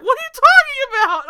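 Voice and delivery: arguing with a TV vampire, loud and incredulous; exasperated outburst. Retold or imitated. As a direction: yell it in disbelief at the screen. Mock argument with the character.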